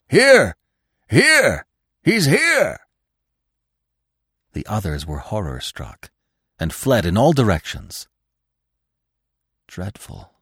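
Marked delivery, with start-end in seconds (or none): 0.1-2.8 high, loud, ringing call. A narrator's reading. Dog standing over the dead fox, loud triumphant call. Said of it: "calling in a deep, loud voice". 9.7-10.3 very soft, hushed dismay; softly. A narrator's reading. Bambi to the old stag in the hollow, very soft. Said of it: "said Bambi softly".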